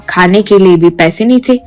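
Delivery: ordinary delivery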